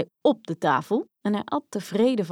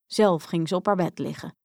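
plain reading voice

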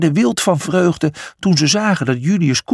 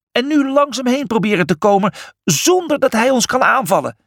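higher, mock shout; a lightly acted yell over the race; 9 semitones higher than usual. A narrator's reading. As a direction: call the plan across, pitched up. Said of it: "schreeuwde Julius".

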